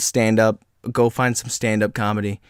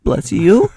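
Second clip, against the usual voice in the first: "Bless you?" higher and louder, as a question; 6 semitones higher, 5 dB louder than usual. Retold or imitated. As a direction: put an uncertain question mark on "Bless you?". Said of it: "Question mark".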